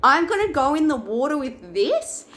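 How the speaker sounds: mock-shocked question, rising; disbelieving. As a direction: pitch jumps high in retold disbelief. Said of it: "I was like".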